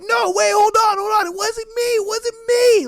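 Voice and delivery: high, loud, panicked protest; begging them to stop, voice cracking up high; desperate pleading. Acted. Guy pleading, dragged out.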